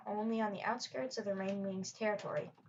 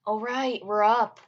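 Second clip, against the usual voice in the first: yawning; 9 dB louder than usual. Acted. Scarface just woken at dawn, answering through a loud yawn. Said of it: "said while she yawned".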